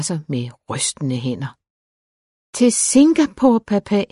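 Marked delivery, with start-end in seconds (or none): 2.6-4.0 high, louder plea to a father; dismayed repeat. A narrator's reading. Daughter questions her father about being sent abroad, dismayed repeat. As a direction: repeat it in high-pitched disbelief. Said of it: "gentog hun".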